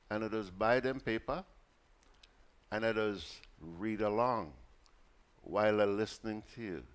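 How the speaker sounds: put-on vendor voice, without real sobs; tearful vendor woman. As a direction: imitate a country woman confiding, in dialect. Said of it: "this lady started crying".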